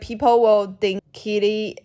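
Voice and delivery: usual speaking voice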